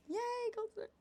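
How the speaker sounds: delighted. Real, not acted. Delighted at finding gold.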